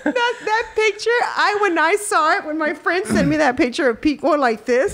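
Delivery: high, breaking into laughter; telling it through giggles; laughing while telling. Real, not acted. Telling friends about the birth photo, laughing hard. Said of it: "I was crying of laughter".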